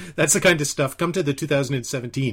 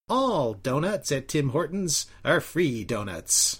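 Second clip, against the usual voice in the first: slow, drawn-out nasal parody; Al Bender; slower than usual. Retold or imitated. Friend showing their silly weekend gag, nasal impression. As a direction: parody a nasally recorded speaker, stretching the words. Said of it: "did that impression once".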